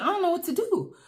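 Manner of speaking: high, mock-distressed plea; upset whine. Asking her boyfriend for help in a high, helpless whine.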